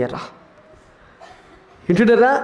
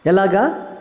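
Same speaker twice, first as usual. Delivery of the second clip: ordinary talk, nothing marked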